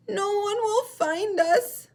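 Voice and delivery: very high, loud, slow squeak by mistake; the penguin voice put on the seal by error; wrong voice. Shown as a demonstration. Teacher's mistaken high voice for Baby Seal. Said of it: "I did the voice wrong".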